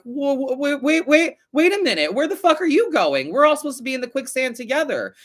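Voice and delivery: high, loud, alarmed protest; playing the jealous friends yelling at you to stay put; mock panicked crowd. Jealous friends protesting someone leaving the quicksand, high and loud. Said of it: "they're like".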